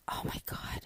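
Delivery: breathy whispered exclamation; hushed exasperated gasp of words; whispered oh my God. Real, not acted. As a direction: whisper it in dismay. Dropped phone, exasperated aside.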